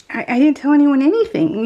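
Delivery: mock-indignant denial; protesting innocence. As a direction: imitate a wrongly accused daughter. Daughter protests innocence, retold.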